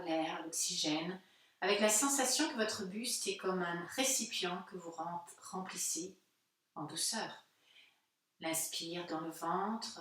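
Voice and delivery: calm, unhurried guided-meditation voice with long pauses. Real, not acted. Yoga teacher guiding listeners' breathing into silence, calm meditative delivery. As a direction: guide listeners gently inward, leaving space between phrases.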